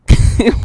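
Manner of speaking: sudden loud burst of laughter; laughing. Real, not acted. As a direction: burst out laughing at being teased. Laughs as chat teases her.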